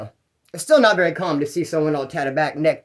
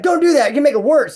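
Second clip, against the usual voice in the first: higher, louder irritated warning; annoyed inmate warning; 9 semitones higher, 5 dB louder than usual. Retold or imitated. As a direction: scold the guy kicking the toilet. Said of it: "he's like".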